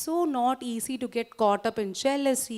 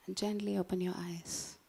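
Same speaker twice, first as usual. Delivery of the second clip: softer, whispered cue to open the eyes; 5 semitones lower, 11 dB quieter than usual. Acted. Guide closing the exercise for the audience, whispering. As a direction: bring them back gently, barely voiced.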